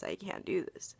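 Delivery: breathy whisper, all voice gone; whispered despair. Retold or imitated. Vlogger voicing her despairing inner thoughts in a whisper. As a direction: let the words fall to a whisper.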